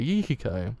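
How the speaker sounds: ordinary delivery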